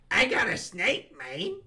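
Adam Sandler impression. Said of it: "Do an Adam Sandler".

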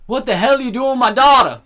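mock mean New York dad, high and loud; New York accent. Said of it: "mean as fuck in the New York, that accent".

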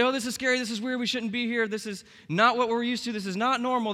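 high, loud hammed-up fretting; spooked disciples. Preacher imagining frightened disciples, hammed-up loud worry. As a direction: pile on the mock panic. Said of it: "But they didn't say".

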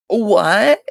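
stunned outburst; shocked what. Real, not acted. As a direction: blurt it out in shock. Reacting to gossip.

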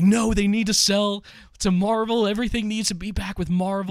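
high, insistent fanboy pleading; past fanboy self. Retold or imitated.

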